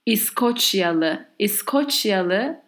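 İskoçyalı said slowly, syllable by syllable; slow Turkish word. Shown as a demonstration. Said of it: "So let's say".

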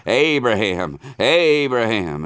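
loud, high call from far above, drawn out on the second name. Acted. The angel calls down to Abraham from heaven, loud urgent call. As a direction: call his name down from heaven to stop him. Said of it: "called unto him out of heaven".

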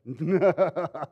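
rapid stuttered mock laugh; silly, hammed-up laugh; mock escaping cackle. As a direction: chuckle in bursts like a comic getaway. Mock Peter swimming gleefully away.

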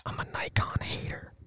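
hushed, whispered admission; whispered confession. Real, not acted. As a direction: whisper it like a secret.

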